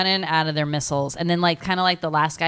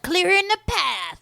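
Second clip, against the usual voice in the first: high mock soldier; 13 semitones higher, slower than usual. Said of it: "We're like".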